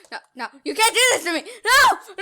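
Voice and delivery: high, fast, loud cry of no; hammed-up terror as he is caught; mock panic. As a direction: melodramatic wail. Mock dying in game.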